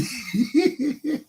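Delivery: high-pitched laugh. Real, not acted. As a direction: crack up at your own story. Laughs after pa's boast.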